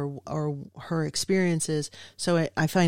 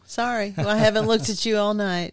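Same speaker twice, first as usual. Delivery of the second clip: laughing; 5 semitones higher, 5 dB louder than usual. Real, not acted. Teased about looking at screen.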